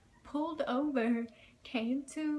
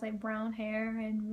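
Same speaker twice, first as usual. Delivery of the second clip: ordinary delivery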